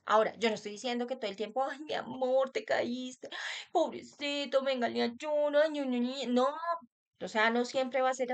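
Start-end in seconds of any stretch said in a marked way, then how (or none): 1.6-6.3 overdone cooing. Retold or imitated. Over-tender parent cooing.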